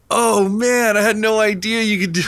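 high-pitched imitation of an awed reaction; mock amazement. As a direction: marvel like someone who just discovered something. Retold amazed reaction to the wrap.

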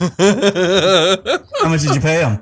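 slow words squeezed out through laughter; laughing. Real, not acted. Friend laughing at coworker story, slow laughing question. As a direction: ask it while cracking up.

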